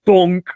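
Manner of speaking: a playful thud noise; bonk sound effect. Retold or imitated. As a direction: make the hard hat hitting the head. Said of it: "he just, like".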